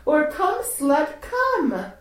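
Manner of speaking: high, sharp commanding call; commanding order. Pretend. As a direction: order them like a mistress. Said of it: "when I say".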